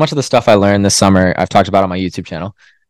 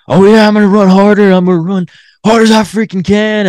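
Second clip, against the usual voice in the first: much higher, louder macho bravado; hyped-up tough guy; 13 semitones higher, 8 dB louder than usual. Retold or imitated. Mocking a Goggins-type runner, hammed-up bravado. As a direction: pump yourself up like a gung-ho runner. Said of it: "this David Goggins type that's just like".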